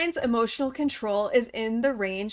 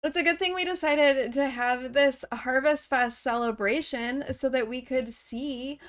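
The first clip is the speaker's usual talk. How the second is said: ordinary talk, nothing marked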